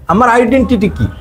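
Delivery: ordinary talk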